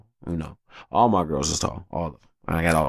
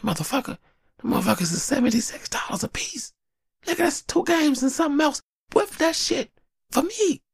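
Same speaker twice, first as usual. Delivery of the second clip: high exasperated rant. Retold or imitated. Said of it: "I'm like".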